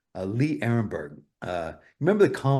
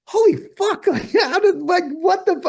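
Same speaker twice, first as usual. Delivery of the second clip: very high, loud, laughing disbelief; amazed crew; 9 dB louder than usual. Retold or imitated. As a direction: squeal it out in stunned awe. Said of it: "they're all like".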